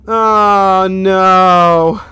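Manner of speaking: loud, high cry of dismay. Real, not acted. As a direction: wail it out in dismay. Dies in game.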